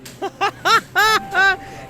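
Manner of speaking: high, loud burst of laughter; laughing. Real, not acted. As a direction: crack up at the clip. Laughing at the clip.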